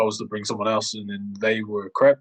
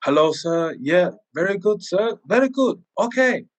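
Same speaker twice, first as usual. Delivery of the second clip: higher, climbing, slow and simple words; speaking simply to a Chinese man, climbing higher; simple English for a foreigner; 7 semitones higher than usual. Retold or imitated. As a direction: talk down kindly to a struggling customer. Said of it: "And then I go".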